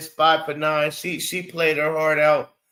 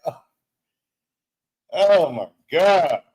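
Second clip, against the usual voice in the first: exasperated; 2 semitones lower, 4 dB louder than usual. Retold or imitated. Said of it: "I'm like".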